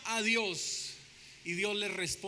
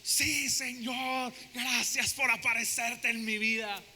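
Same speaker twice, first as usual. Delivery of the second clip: grateful reply; slower than usual. Acted. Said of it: "A mí se me aparece el Señor así, yo".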